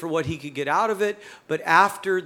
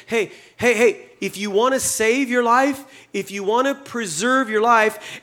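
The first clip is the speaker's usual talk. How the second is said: calling out; 5 semitones higher, 4 dB louder than usual. Acted. Jesus calling out to crowd.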